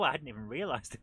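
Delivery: ordinary talk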